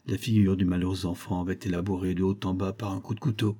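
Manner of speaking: plain reading voice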